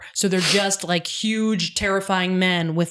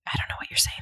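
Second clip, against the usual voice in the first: mumbled quiet; 6 dB quieter, much faster than usual. Pretend. Mocking people who talk too quietly.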